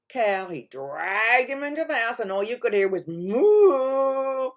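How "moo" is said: long, loud mooing like a cow. Acted. Storyteller mooing the cow, loud and drawn out.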